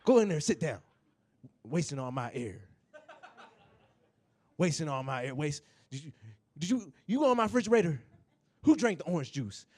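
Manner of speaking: a hint of a cranky old granddad; grumpy old granddad. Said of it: "granddad scene".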